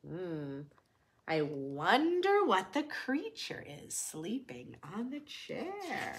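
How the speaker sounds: plain reading voice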